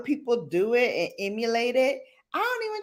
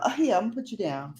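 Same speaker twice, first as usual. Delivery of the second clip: ordinary delivery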